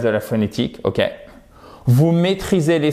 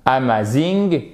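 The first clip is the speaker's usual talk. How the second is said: AMAZING with a French accent, evenly stressed A-MA-ZING; slower than usual. Shown as a demonstration. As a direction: say AMAZING as French syllables, no diphthong. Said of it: "ça ne va pas le faire".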